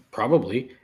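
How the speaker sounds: normal talking voice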